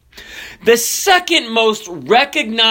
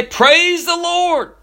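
high, drawn-out "Praise the Lord"; demonstrating a command; 11 semitones higher, much slower than usual. Acted. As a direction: call it out slowly to a horse. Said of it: "Just say".

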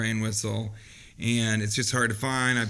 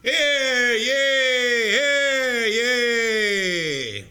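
bigger, higher crier call; town crier; 8 semitones higher, much slower than usual. Shown as a demonstration. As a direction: ring it out like a herald. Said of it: "Yeah, that's better".

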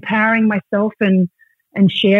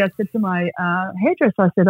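usual speaking voice

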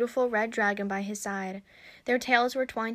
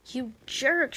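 mild narrated yell, pitched higher; yelled insult; 4 semitones higher than usual. A narrator's reading. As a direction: throw the insult at him, not full volume. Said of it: "she yelled at him".

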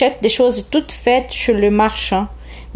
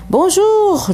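voix du renard; 10 semitones higher than usual. Acted. Le renard salue le prince.

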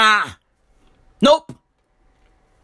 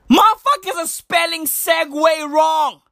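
ordinary talk, nothing marked

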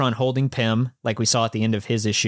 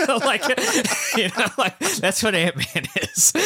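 talking through real laughter, higher voice; laughing; 11 semitones higher than usual. Real, not acted. Co-host cracking up over what Ant-Man is, laughing. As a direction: laugh your way through the words.

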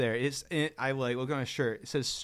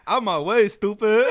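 loud, raised mock demand; brash call-out; 6 semitones higher, 7 dB louder than usual. Shouting at a guy in the way, brash mock yell. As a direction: bark it like a joking catchphrase.

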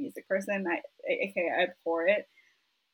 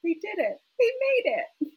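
high-pitched mock tearful squeak; choked up with pride for them, hammed-up; teary-eyed; 11 semitones higher, 3 dB louder than usual. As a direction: squeal it as if welling up. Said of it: "I get very teary-eyed".

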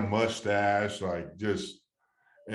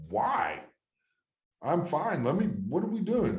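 incredulous protest; 9 semitones higher than usual. Retold or imitated. Retelling his angry protest at being pulled.

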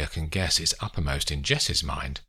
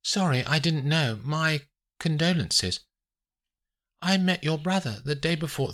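high-pitched character voice, condoling; lightly voiced Olivia, apologetic; 10 semitones higher than usual. A narrator's reading. Olivia offering Scott condolences on his brother, apologetically. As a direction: offer condolences in a raised, careful voice.

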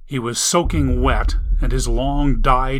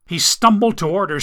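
ordinary delivery

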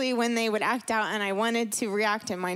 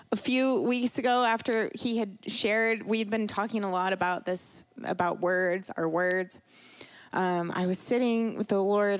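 usual speaking voice

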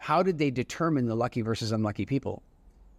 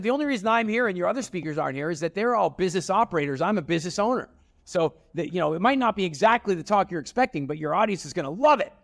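retold raised voice; 6 semitones higher than usual. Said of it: "I just shouted at Tony".

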